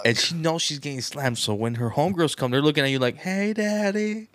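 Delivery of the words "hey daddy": high, very slow sultry drawl; flirty greeting. Retold or imitated. Homegirls flirting with the husband, slow and sultry. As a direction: draw it out seductively.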